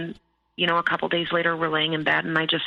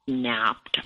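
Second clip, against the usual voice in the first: ordinary talk, nothing marked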